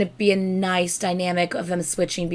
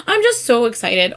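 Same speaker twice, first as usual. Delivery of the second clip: ordinary talk, nothing marked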